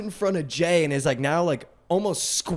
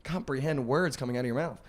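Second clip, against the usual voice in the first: muttered disbelief; 11 dB quieter than usual. Retold or imitated. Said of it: "I was just like".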